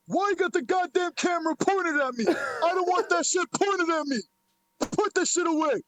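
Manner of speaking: high put-on voice, irked and insistent; E B Z impersonation. Streamer imitating a man objecting to the camera, impersonation. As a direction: imitate an annoyed man's orders, pitched well up. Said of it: "let me do my E B Z impersonation".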